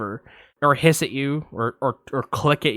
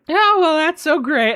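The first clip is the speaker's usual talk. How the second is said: high-pitched mock delight; gushing neighbor; 12 semitones higher than usual. Neighbor happy about her cat, high gushing imitation. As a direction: put on a high, oblivious cheer.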